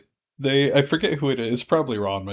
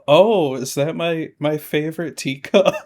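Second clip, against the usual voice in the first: high, raised, put-on voice; imitating innocent Kasumi; 5 semitones higher, 5 dB louder than usual. Kasumi spotting her favorite teacup, mock innocence. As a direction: play guileless surprise, pitched up.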